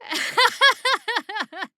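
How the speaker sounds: high, amused laugh; bright mocking laugh. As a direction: let a laugh ring out. Laugh alone on line.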